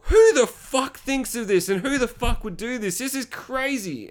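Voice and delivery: high, incredulous outburst; high-pitched baffled outrage; appalled disbelief. Retold or imitated. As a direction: react in disbelief, pitched well up. Acting out his shocked reaction.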